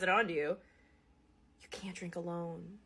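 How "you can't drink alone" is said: ominous school counselor. Retold or imitated. School staff warning teens, hushed ominous murmur. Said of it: "they just go".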